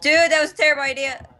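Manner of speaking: exasperated. Real, not acted. Scolding friend after failed plan.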